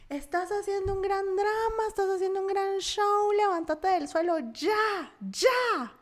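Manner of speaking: high, slow-stretched scolding yell; gritos imitados. Retold or imitated. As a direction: mimic him yelling accusations, ending in loud orders. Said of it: "Y me empieza a gritar". (regaño a gritos, imitado, agudo y alargado)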